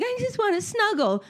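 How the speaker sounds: put-on very high, sweet little-boy voice; little kid voice. Mom quoting her son asking to snuggle, high childlike plea. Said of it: "he was like".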